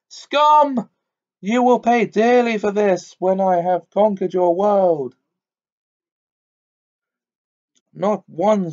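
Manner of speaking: high, loud, triumphant witch cry; the witch threatening the crowd, ringing and triumphant. Acted. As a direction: ring it out like a great bell, gloating. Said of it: "like a clear great bell".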